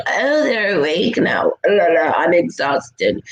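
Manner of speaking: lightly voiced jaded sigh; drawled-out tired mockery; a hint of a jaded old soul; weary old soul. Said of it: "So we're like".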